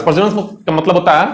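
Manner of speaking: ordinary delivery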